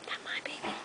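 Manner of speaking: whispering. Real, not acted. Tender whisper to baby.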